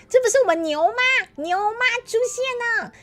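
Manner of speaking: high, loud excited welcome; 惊喜欢呼. Real, not acted. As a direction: cheer a friend's arrival, drawn out. Greets a viewer who just arrived. (高声惊喜地欢呼)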